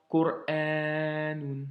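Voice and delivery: slow "Qur'anun", the "a" held long; drawn-out reading demo of "Qur'anun"; long A after hamza. Shown as a demonstration. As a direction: stop after "Qur", then stretch the "a". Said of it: "qui donne a".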